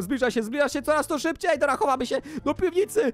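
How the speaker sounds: urgent, repeated calls climbing in pitch; playful panic. Pretend. Gamer fleeing the tornado to the cellar, playful panic. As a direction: rush for shelter in mock panic.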